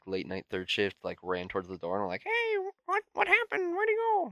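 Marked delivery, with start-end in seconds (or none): 2.2-4.3 put-on very high-pitched flustered old lady; high mock old-lady voice. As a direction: squeal it like a panicked old lady. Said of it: "all the old ladies who were working".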